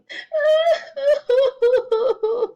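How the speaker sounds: loud, high mock boo-hoo sobs. A narrator's reading. Baby llama cries for mama, hammed-up boo-hooing. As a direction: bawl in exaggerated boo-hoos. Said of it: "Baby llama starts boo-hooing".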